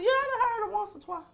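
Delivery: high, quick, knowing voice; sly reply. Retold or imitated. Comedian answers the stranger in a high sly voice. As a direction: answer innocently while hiding the truth.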